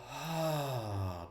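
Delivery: long soft sighing exhale; breathy release sigh; demo sigh. Shown as a demonstration. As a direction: sigh it all out. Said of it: "make some nice sigh noises".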